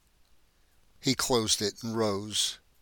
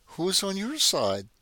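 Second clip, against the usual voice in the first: put-on much higher, a young girl asking; small child's question; 9 semitones higher than usual. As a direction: lift it up into a little sister's voice. Little sister asks softly.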